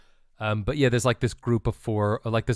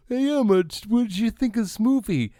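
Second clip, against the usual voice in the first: imitating himself; 11 semitones higher than usual. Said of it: "And then I go".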